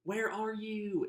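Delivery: high, drawn-out Southern drawl; deep Southern woman. Acted. Sister phoning brother in a put-on Southern woman's accent. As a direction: play up a slow Southern woman, pitched high. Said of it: "a really deep Southern woman".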